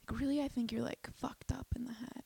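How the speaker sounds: hushed, breath-only requote; disbelieving whisper. Retold or imitated. Repeats the text in disbelief.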